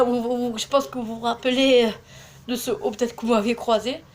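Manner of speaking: ordinary talk, nothing marked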